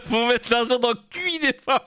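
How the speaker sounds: high, laughing imitation of an Ivorian accent; mock Ivorian accent. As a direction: mimic an Ivorian woman's accent through giggles. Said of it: "elle parle avec un accent ivoirien". (imitation de l'accent ivoirien en riant)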